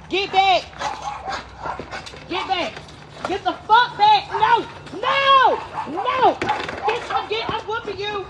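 high, sharp scolding command; scolding a runaway, threatening a whooping; angry scolding. Real, not acted. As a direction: chase it off, furious.

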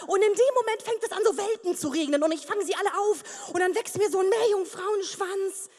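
ordinary delivery

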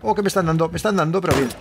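usual speaking voice

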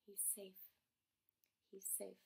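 barely audible, near whisper; hushed. Acted. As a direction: say it very softly, almost whispering. Hushed relief before the danger returns.

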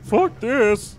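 loud, high fed-up exclamation; mock bail-out. Voicing the ally who runs from the boss, loud fed-up outburst. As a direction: throw the line out like quitting.

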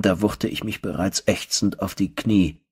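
ordinary delivery, nothing marked

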